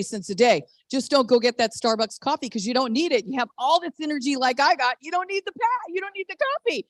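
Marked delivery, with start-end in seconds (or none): 5.0-6.9 high-pitched and softer, teasing urging; playful insistence. Real, not acted. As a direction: push it up high and light, insisting.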